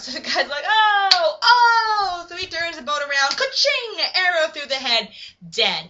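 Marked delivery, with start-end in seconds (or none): 0.7-2.3 loud, high mock wail; drawn-out cry of dismay; acted shout at a wipeout; alarmed "oh". Acted alarm at water-ski fall. 3.4-4.0 high-pitched ka-ching sound effect; vocal impact noise, punched high; imitated arrow strike.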